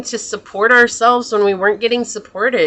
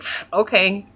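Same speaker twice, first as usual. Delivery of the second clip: unbothered; 4 dB quieter than usual. Retold or imitated. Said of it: "you're just unbothered by it".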